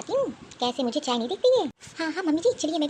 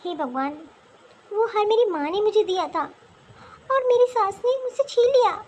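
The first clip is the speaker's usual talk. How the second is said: aggrieved lament; 6 semitones higher than usual. Acted. Daughter-in-law laments taken necklace.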